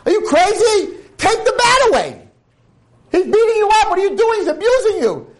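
horrified shout. Retold or imitated. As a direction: scream at her to stop him. Friend shouting in horror.

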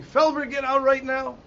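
high-pitched retold scream; screamed order. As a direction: imitate a commander yelling an order. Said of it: "he starts screaming".

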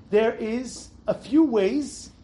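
normal talking voice

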